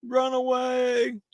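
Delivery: high, slow mock panic cry; a mock-heroic flee call, drawn out; hammed-up Monty Python run away; Monty Python quote. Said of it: "to quote Monty Python".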